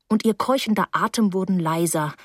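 usual speaking voice

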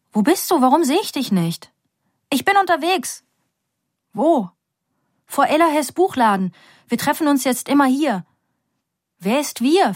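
anxious aunt asks where Nika is; urgent phone call. Acted. (besorgte Tante fragt am Telefon)

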